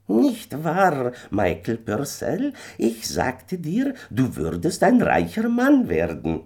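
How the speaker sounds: high, eerie little fairy man, pleased with himself; a knowing little man calling out, pitched high; little man's voice. A narrator's reading. Little man calls to Michael on the hill, high and eerie.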